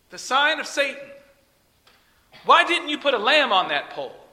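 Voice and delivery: high-pitched, insistent imitation of a preacher; Kenneth Copeland. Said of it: "And then he went on".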